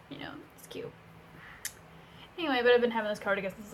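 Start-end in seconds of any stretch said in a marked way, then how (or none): 0.1-0.9 soft whispered aside. Real, not acted. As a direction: breathe it quietly to yourself.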